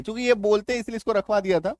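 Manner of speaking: normal talking voice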